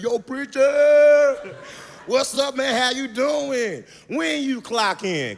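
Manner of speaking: high, loud, slow mock fan voice; fans greeting him. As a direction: call out like an excited fan. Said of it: "They were like".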